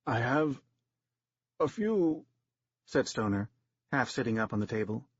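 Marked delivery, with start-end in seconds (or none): 0.1-2.2 lightly shivering actor's voice; dying actor mustering last words, mildly shaky. A narrator's reading. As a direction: shake through the words. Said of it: "shivering and shaking violently".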